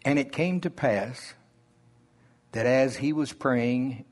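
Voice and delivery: slow, deliberate Bible reading; scripture reading. Acted. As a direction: read each phrase as if it were sacred. Reads scripture slowly.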